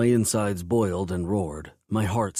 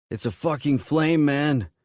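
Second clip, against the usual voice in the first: higher and louder, alarmed; shout; 7 semitones higher, 4 dB louder than usual. A narrator's reading. Biker warning his boss from a table, shouting. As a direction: call it across the yard. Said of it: "Tricky shouted".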